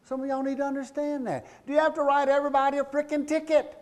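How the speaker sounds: high-pitched, hammed-up scolding; mock exasperation. Speaker scolding officers over writing tickets, mock exasperated high yell. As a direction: playfully berate them at the top of your range.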